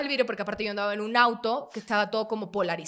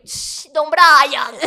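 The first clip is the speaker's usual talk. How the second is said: slow, loud, higher wink at the cop; hushed knowing greeting; 5 semitones higher, 10 dB louder, much slower than usual. Retold or imitated. Alex greeting the officer knowingly, slow and drawn out. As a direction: drag it out like a secret greeting. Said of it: "Alex bajaba el brillo".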